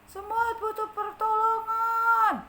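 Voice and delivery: high, slow, loud onlooker; passerby voice. Acted. A passerby notices the struggling ant. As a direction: announce slowly that help is needed. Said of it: "katanya gitu".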